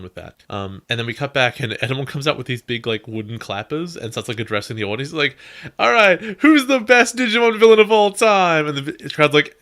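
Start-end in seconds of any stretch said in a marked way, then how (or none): 5.8-8.7 loud, high hype-man call to the crowd; villain pumping up the theatre crowd, loud and high; showman villain. Retold or imitated. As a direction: work the audience like a ringmaster. Villain hypes up the audience.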